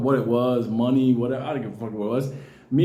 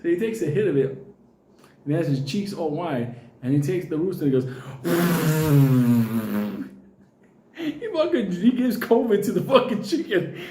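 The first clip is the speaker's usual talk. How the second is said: ordinary talk, nothing marked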